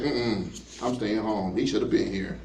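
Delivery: low, soft grumble with attitude; sulky attitude. Acted. Mary refusing to go out to meet Jesus, with attitude. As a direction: refuse under your breath, put out. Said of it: "almost like she has an attitude".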